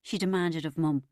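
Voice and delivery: plain reading voice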